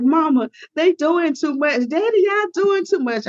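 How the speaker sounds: a hint of a teen whining to her parents; whiny daughter. Daughter complaining to mom and dad, mildly whiny. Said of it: "at first she was like".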